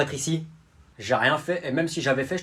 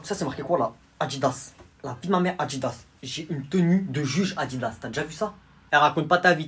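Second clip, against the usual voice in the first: ordinary delivery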